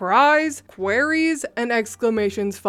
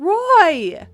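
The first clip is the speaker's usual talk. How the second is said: much higher, louder yell of his name; scream; 7 semitones higher, 7 dB louder than usual. Retold or imitated. Wife screaming at her husband in the diner. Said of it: "his wife screamed again".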